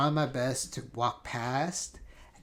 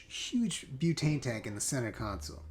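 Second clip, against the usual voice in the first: ordinary talk, nothing marked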